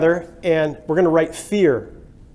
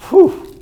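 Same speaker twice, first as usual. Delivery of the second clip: a sharp high whoosh of breath, much louder; startled whew; 9 dB louder than usual. Retold or imitated. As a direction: blow out a panicked whew. Voicing a scared employee.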